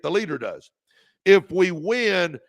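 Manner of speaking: ordinary delivery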